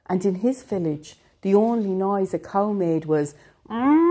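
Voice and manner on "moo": high loud moo like a cow. Acted. Narrator mooing like a village cow.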